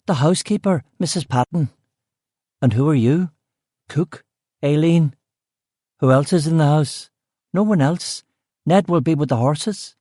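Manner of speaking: matter-of-fact Belfast cook. Acted. Cook listing the household to the detective, in a Belfast accent.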